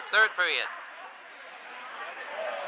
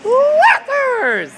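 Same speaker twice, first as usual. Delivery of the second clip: long, high, loud cheering shout; big cheer; 10 dB louder than usual. Real, not acted. Announcer finishing the spelled cheer with a huge high yell. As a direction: belt the team name out to the crowd.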